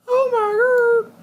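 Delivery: squealing with joy, drawn out; excited squeal. Real, not acted. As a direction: shriek it out in delight. Sudden excitement over game.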